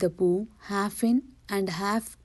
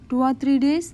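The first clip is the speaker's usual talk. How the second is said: higher, louder questioning "Two or three days"; surprised echo; 5 semitones higher, 5 dB louder, faster than usual. A narrator's reading. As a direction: repeat "Two or three days" as a startled question, rising at the end. Pooh echoes the estimate in dismay.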